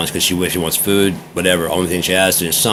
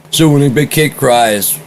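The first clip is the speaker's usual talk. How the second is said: higher, louder, slow put-on voice; mocking the ex; 7 semitones higher, 5 dB louder than usual. Ex trying to prove him wrong to the doctor, slow mocking voice. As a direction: mimic the ex's loaded question.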